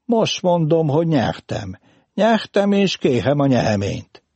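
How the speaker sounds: insistent and high, R softened in "nyeheményt"; burred R. A narrator's reading. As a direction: insist with an R defect, "kéhem" for kérem. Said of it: "Most nem racsolt".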